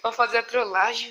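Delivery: loud laughing talk. Real, not acted. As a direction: laugh through the words. Laughs at the prank.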